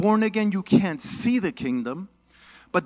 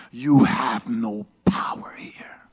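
low, slow whispered snarl; scary demon voice; 8 semitones lower, slower than usual. Acted. Possessed girl threatening Nicodemus, low whispered menace. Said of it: "She's like".

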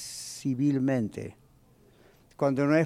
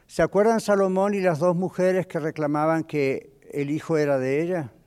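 normal talking voice

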